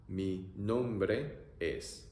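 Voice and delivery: very slow "Mi nombre es." stretched; teacher modelling slowly; slow demo. Shown as a demonstration.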